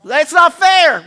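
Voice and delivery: high, loud mimicked kid's protest; complaining child. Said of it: "when they say that".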